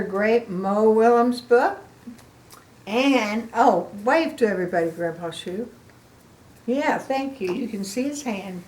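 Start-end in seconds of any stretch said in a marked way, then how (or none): none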